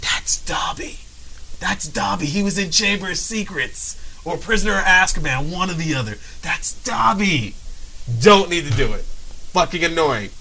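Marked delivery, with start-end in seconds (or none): 0.0-7.6 excited whisper. Retold or imitated. Fan whispering about Dobby to a stranger. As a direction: lean in and hiss it. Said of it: "you're a complete stranger".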